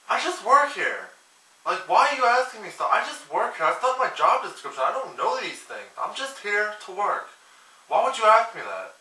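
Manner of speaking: mock-indignant outburst at a dumb question; exasperated rant. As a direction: snap back like an annoyed cashier who just works here. Exasperated worker ranting at customer.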